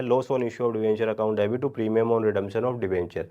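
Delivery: whole entry in one unbroken run; recited straight through in one go; running recap. Real, not acted. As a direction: rattle off the entry without pauses.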